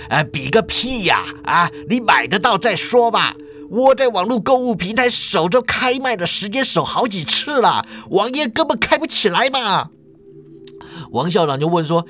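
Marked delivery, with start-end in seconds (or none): 0.1-9.9 high, griping otaku voice; Gui Zhai. Acted. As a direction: complain up high, fed up. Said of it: "鬼宅就走进来了".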